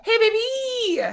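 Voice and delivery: high, squealed hello; high-pitched happy holler; excited greeting. Real, not acted. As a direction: call out flirtily to a friend. Calling out to arriving chat guest.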